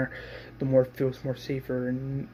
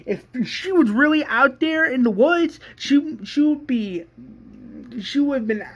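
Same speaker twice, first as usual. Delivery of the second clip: much higher, loud heated yelling; retold screaming; 12 semitones higher, 6 dB louder than usual. Shane yelling at Daryl about the lost girl, high loud rant. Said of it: "Shane screams and says".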